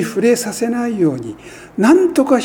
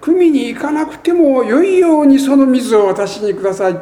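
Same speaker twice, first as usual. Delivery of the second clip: pleading woman; 8 semitones higher, 5 dB louder than usual. Acted. Woman's request quoted in sermon.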